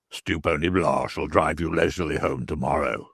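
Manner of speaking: a worried man swearing under his breath; Stracey's voice. Acted.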